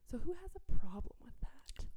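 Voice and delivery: sly hushed question; whispered challenge. Retold or imitated. Leader's teasing question to group.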